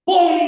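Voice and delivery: high loud blast of bom!; explosion bom. Acted. Preacher miming pent-up anger exploding, shouting.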